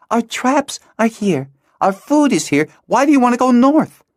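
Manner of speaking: put-on argue, exasperated, as a girl; a girl protests against going north, frustrated. Hala argues against leaving.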